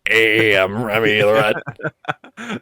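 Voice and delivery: low cartoon rat voice; Remy the Rat impression. Joking with a friend about a card, in a low goofy rat voice. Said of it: "my favorite impersonation thing to do".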